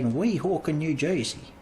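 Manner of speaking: a light put-on New Jersey accent. Friend tries a weak New Jersey accent on the town name. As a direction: say it like a half-hearted New Jersey local. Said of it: "Get that New Jersey accent".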